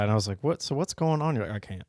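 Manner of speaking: usual speaking voice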